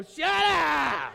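high, loud shout with a laugh. Pretend. Fed-up listener yells at a pushy do-gooder, laughing. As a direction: blurt it out in exasperation. Wanting to yell at them.

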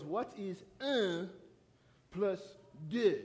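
put-on French teacher accent, slow and spaced out. Teacher quizzing the French class on numbers, French accent. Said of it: "try to do a French accent".

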